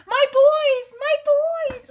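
loud, very high squeal; Sassy. Acted. Cat hopping towards her dogs, high ecstatic cry. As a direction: squeal it out overjoyed. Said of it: "She's like".

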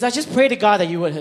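put-on loud, high child's complaint; retold blurting. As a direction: imitate a kid's frustrated outburst. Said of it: "he blurts out".

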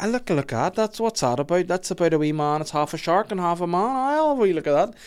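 high, eager chatter; acted eager browsing. Browsing the video shop for a film, high eager curiosity. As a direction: act out a kid spotting an intriguing find.

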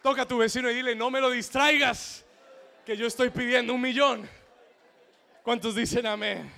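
loud, high rallying shout; booming preacher's call; full-force preaching. Real, not acted. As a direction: fire up the congregation.

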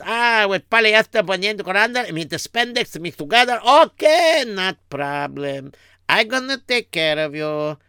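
high-pitched opening, easing down as he obliges; eager accommodating tailor. Acted. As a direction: play an obliging tailor with an accent. Acts the tailor in the story.

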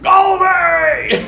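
high yelled "Goldie!" across the field; retold shout calling a dog far off. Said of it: "and he's like".

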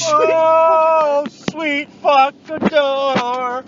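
loud, very drawn-out cry; hammed-up cry at the door; mock outcry. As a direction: wail it out, very slow. Cries out at a door.

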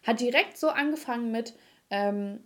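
ordinary talk

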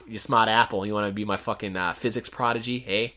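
badly faked Boston accent; Matt Damon. Mock celebrity guest answering an interviewer, bad Boston accent. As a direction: put on a rough Boston movie-star voice. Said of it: "Matt Damon is here".

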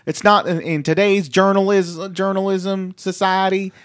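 loud, high mocking quote; mocking tweet read. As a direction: read it like a ranting post.

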